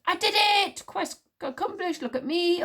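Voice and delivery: high, exultant boasting shout; triumphant shout. A narrator's reading. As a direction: shout it out like a champion. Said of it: "he punched the air and shouted".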